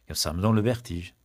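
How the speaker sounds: ordinary talk, nothing marked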